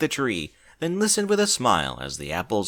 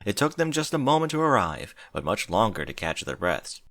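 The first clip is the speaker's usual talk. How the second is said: ordinary delivery, nothing marked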